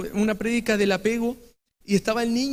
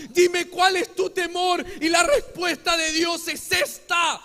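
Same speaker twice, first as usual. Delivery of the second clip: rising pulpit fervour, loud and higher; fiery preaching; 5 semitones higher, 3 dB louder than usual. Acted. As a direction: preach it out to the whole church. Preaching at full force.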